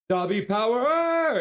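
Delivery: high, loud, stretched chant; cheer. Real, not acted. Streamer hyping chat with a loud slow cheer. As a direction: call it out like a rally cry.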